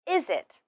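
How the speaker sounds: "Is it" run together through the z; linked z. Shown as a demonstration. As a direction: carry the z of "Is" into "it". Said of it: "link the final z sound of is".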